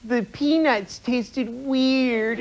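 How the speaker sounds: slow, whiny grumble about the flight; whiny complainer. Retold or imitated. Comedian mimicking passengers who complain about flying, whiny. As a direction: drag it out like a spoiled flyer. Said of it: "Everybody complains about".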